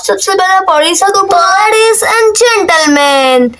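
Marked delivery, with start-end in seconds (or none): 1.3-3.5 slow, drawn-out announcer call; grand show-host opening; host announcement. Acted. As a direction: stretch it like a stage host.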